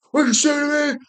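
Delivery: very high-pitched imitated outrage; hammed-up confrontation in a high voice; mock angry retort. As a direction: act out an indignant comeback. Coach turns on the heckler, retold.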